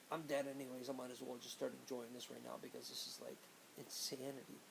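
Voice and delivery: soft, low-key inner monologue; resigned thought. Retold or imitated. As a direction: think it aloud quietly, giving up.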